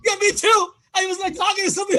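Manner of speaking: agreeing he vanished on screen, loud high; mock shout. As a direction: shout it out in squeaky disbelief. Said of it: "I'm like".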